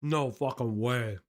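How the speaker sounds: talking with a carrot in mouth; mouth full. Real, not acted. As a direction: say it through a mouthful. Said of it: "I have a carrot in my mouth".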